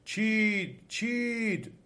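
high, drawn cry of the trees. A narrator's reading. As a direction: call it out high, like trees raising the alarm.

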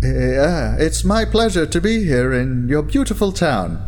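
a touch of nerves under a polite greeting; nervous. A narrator's reading. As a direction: lift the pitch, uneasily polite. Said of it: "you say nervously".

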